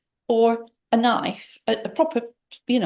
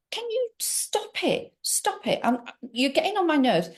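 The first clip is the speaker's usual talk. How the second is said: high, slowed and hushed, fed up; irritated plea; 6 semitones higher, 4 dB quieter than usual. Retold or imitated. To her husband replaying the song, a hushed exasperated plea. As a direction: tell him to stop, tight and exasperated.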